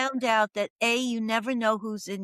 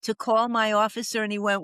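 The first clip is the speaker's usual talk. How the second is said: ordinary talk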